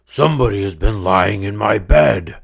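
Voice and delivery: big loud bear voice; great rough gruff bear voice. Acted. Great huge bear finding his bed disturbed, loud bear voice. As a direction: boom it out like the biggest bear. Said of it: "in his great, rough, gruff voice".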